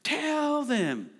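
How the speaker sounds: almost scream it, then hold back; toned-down version of a scream for the sound desk; held-back scream. Retold or imitated. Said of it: "I wish I could do it without freaking out the sound".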